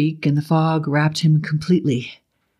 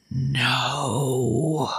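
soft, hushed no; drawn-out hush; near whisper; 3 semitones lower, 5 dB quieter than usual. A narrator's reading. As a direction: say it barely above a whisper. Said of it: "It was barely above a whisper".

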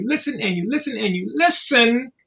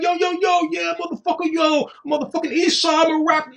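mock rapper; 10 semitones higher than usual. Taunting a jailed rapper in a high mock rap voice. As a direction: ham up a cocky rapper, pitched way up.

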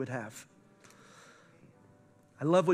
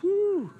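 high mock "woo" at a passing girl; imitating a leering whoop; mock catcall; 13 semitones higher, 4 dB louder than usual. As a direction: yelp it up high like an ogling dad. Mocking a dad checking girls.

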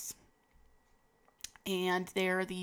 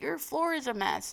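lightly voiced Frog; 9 semitones higher than usual. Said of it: "or Frog is like".

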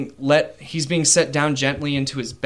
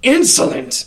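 much higher, louder snap of outrage; guard's outburst; 11 semitones higher, 6 dB louder than usual. Acted. Guard to the laughing prisoner, outraged shout. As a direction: spit out the word in fury. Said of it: "the guard says".

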